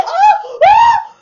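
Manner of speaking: shocked shriek. Retold or imitated. Friend shrieking when the man grabs her. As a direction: yelp in outrage at being grabbed. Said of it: "And she said".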